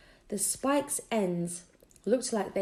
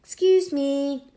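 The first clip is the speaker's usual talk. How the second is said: polite call; 5 semitones higher, 8 dB louder, slower than usual. Acted. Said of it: "Trying to say".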